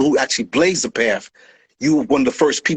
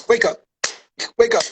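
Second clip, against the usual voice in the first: higher, hammed-up "wake up" to a fake; mock wake-up call; 6 semitones higher than usual. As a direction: shake someone out of a delusion, jokingly. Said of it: "slap him".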